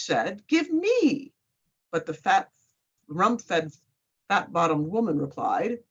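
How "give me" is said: high, greedy witch demand. Acted. Witch demands chestnuts from the sailor's wife, high and greedy. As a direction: grab at it with a much higher voice.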